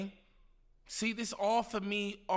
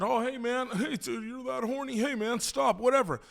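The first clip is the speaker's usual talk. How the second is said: lightly voiced nervous friend, half laughing; mocking his friend; 5 semitones higher than usual. Mocking the friend who laughed it off in the car, light nervous voice. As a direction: brush him off with a nervous laugh. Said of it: "the way he said".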